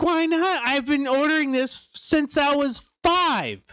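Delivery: high, indignant mock whine; complaining to the Taco Bell worker, high and indignant; outraged disbelief. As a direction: whine it high like a betrayed kid. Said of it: "And I'm like".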